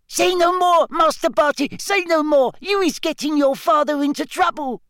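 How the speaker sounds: very high, squealing house-elf shriek; frantic elf pleading. A narrator's reading. House elf begging her young master to keep silent, shrill pleading. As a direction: beg him desperately to stop.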